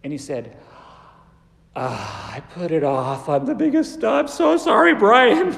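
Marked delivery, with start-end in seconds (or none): 1.8-5.5 from a sigh to a loud pleading apology; rising apology. Retold or imitated. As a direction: blurt out the confession, building louder. Student confesses, rising apology.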